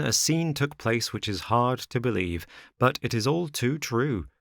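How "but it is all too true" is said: usual speaking voice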